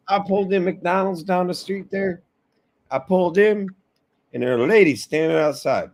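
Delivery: high-pitched Southern storyteller; Southern guy. Retold or imitated. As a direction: retell it high as a Southern customer. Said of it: "he was a Southern guy too".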